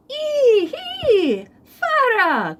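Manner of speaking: high, loud yelp of pain; crying out. Acted. Rabbit yelping as the rock bounces off his head. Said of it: "cried Rabbit".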